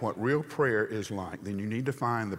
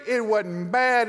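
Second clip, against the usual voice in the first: high, loud, slow grumble on "bad"; griping; 11 semitones higher, 7 dB louder, slower than usual. Hebrews sulking at Moses with a high, loud grumble. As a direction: drag out "It wasn't bad." like a sulking crowd. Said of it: "The Hebrews tell Moses".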